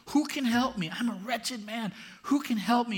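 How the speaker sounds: loud, high anguished cry. Acted. Paul crying out to God, loud and high. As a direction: cry out for rescue, desperate. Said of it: "He's crying out".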